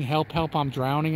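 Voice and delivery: ordinary talk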